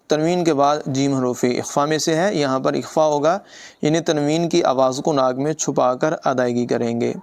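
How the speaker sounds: ordinary talk, nothing marked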